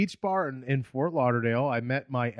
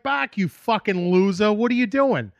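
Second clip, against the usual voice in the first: put-on Boston accent; 7 semitones higher, 6 dB louder than usual. Bostonian razzing a friend he runs into, loud Boston accent. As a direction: bellow an affectionate Boston insult. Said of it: "that Boston accent thing".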